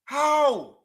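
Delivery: loud, high "How?"; incredulous outburst. Real, not acted. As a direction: exclaim it high and loud in disbelief. Opening outburst of disbelief at the lineup.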